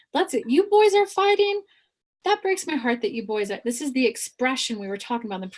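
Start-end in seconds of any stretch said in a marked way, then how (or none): 0.1-3.6 acted plea. Speaker voices an appeal to fighting boys, pleading. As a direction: plead with them, pitch lifted high.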